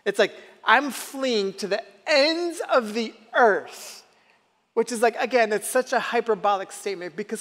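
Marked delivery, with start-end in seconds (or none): 0.6-4.0 high, slow, stretched-out exaggeration; mock-dramatic proclamation. Preacher voicing Jonah fleeing God, mock-dramatic and drawn out. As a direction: play up the hyperbole, drawing it out high. Acting out Jonah's hyperbolic flight.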